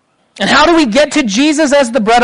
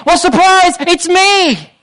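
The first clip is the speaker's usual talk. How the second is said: high, punchy mock surprise announcement; spring the answer on them, pitched high; playful big reveal; 7 semitones higher than usual. Voicing Jesus's surprise answer to crowd.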